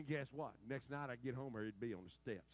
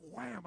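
mock wham; 13 semitones higher, 9 dB louder than usual. Imitated slam sound.

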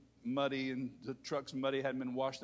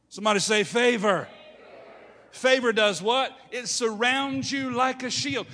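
loud, raised pulpit proclamation; full-force preaching; 9 semitones higher, 12 dB louder than usual. Real, not acted.

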